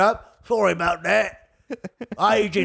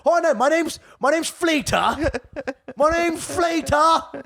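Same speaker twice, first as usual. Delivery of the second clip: high, slow cockney voice through laughs; mock trashy British; 9 semitones higher, slower than usual. Comic mocking trash British names, high cockney accent. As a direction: ham up a trashy Brit. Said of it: "trash British will change up".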